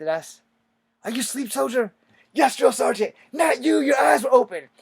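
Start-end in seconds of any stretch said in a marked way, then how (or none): 1.0-1.9 high, loud sergeant bark; imitating a drill sergeant. Drill sergeant questioning recruit. 2.3-4.7 high, loud military shout; mock parade-ground yell; shouted reply. Retold army exchange, shouting.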